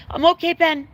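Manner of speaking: tearful reassurance. Real, not acted. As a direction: tell the dog you're okay while still teary.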